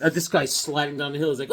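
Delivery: ordinary talk, nothing marked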